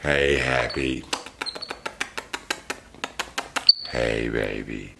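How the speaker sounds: low, slow, soft cooing. Real, not acted. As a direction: coo gently to the pet. Cooing to the calm monkey.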